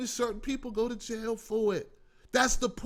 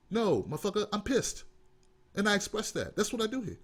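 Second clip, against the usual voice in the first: normal talking voice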